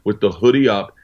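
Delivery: ordinary talk, nothing marked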